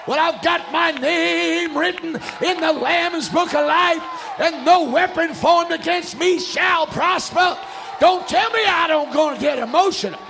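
loud, high shouted sermon; fervent preaching. Real, not acted. Preacher defending emotional worship to the congregation, fervent preaching. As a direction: shout it out with mounting fervour.

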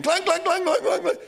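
high, loud ringing clangs; clanging imitation. Teller mimicking pipes clanging like alarm bells.

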